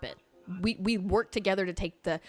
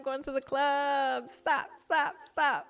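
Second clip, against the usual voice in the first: hyped; 6 semitones higher, slower than usual. Retold or imitated. Imitating her hype.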